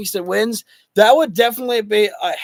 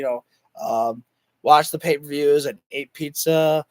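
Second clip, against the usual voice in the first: strained, fading voice; losing voice; 9 semitones lower, 4 dB quieter than usual. Real, not acted. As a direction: voice giving out, quiet and low. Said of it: "I am completely losing my voice right now".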